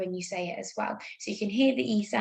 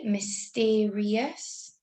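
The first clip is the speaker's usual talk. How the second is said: slow spelling dictation; much slower than usual. Shown as a demonstration. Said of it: "I can hear that one".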